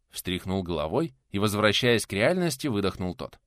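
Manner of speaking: plain reading voice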